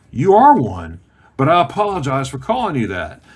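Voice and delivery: loud, emphatic mock-dad verdict; backhanded apology. Dad apologizing to his son for name-calling, bluntly emphatic. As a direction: come down hard, imitating a stern dad.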